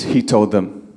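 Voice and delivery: ordinary delivery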